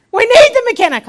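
loud, high mock protest; exasperated refusal. Pastor turns down her suggestion, exasperated. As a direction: cry out in comic exasperation.

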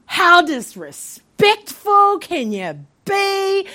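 slow, high indignant retelling; fuming. Retold or imitated. Patient fuming to herself at the doctor, slow indignation. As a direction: draw it out in acted indignation. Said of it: "So I'm fuming".

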